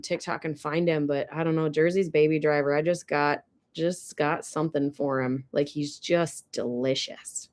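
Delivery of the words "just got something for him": normal talking voice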